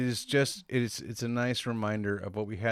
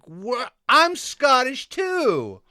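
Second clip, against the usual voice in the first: higher, slow, louder mock tourist voice; mocking American tourist; 12 semitones higher, 6 dB louder, much slower than usual. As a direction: ham up the indignant American. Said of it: "the Americans come and they're like".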